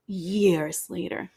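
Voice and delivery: slow, stretched "years later" with a rising end; drawn-out first try. Shown as a demonstration. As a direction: drag out "years later" like a dramatic reveal. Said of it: "I'm saying l-, years later".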